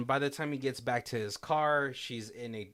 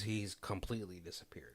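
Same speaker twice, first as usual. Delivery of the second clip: softer, hushed reveal; dramatic whisper; 4 semitones lower, 10 dB quieter than usual. Real, not acted. Telling a friend the hero has vanished, in a whisper. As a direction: drop to a hush for the twist. Suspenseful moment in retold plot.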